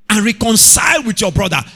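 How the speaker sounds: high, loud call; preaching shout. Real, not acted. Preacher answering his own question to the church, loud. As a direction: punch out the answer.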